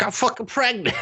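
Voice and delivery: high, exasperated blurt; mock outraged girl. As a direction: squeak it out like you can't believe it. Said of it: "She's like".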